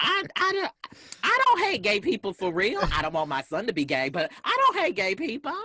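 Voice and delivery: very high, loud mock protest; defensive mock quote. Speaker mimicking people who deny their homophobia, high-pitched and defensive. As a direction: imitate a squeaky, insistent denial. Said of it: "they're like".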